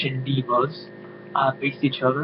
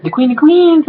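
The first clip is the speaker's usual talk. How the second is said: much higher, louder sneering title; mocking title; 13 semitones higher, 9 dB louder than usual. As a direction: sneer the title up high and loud.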